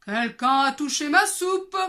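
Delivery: loud grumbling papa bear; big bear growling. Acted. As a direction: roar it like a big angry bear. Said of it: "gronda de sa grande voix".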